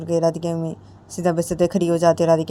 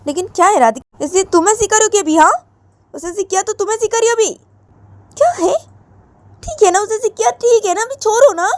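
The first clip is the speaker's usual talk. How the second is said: scolding mother; 12 semitones higher, 6 dB louder than usual. Acted.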